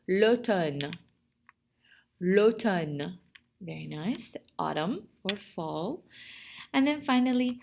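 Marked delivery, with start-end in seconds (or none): 0.1-3.2 l'automne pronounced clearly for learners; model pronunciation. Shown as a demonstration. French teacher has the class repeat, l'automne modelled clearly. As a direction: say l'automne distinctly as a word to repeat. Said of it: "Répétez, s'il vous plaît".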